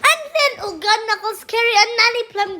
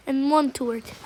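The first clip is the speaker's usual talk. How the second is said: ordinary delivery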